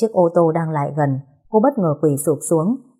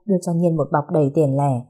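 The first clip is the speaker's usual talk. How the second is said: plain reading voice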